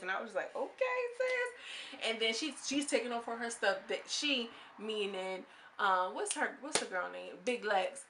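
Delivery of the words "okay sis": high, slow and drawn-out okay sis; sarcastic squeaky okay sis, dragged out; mock okay sis. Mock reaction to finger-pointing.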